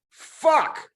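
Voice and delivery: sudden very high, loud curse; furious cry. Acted. Julian hurls the radio, crying out in fury. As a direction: explode with the word "Fuck!". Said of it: "Julian cried out".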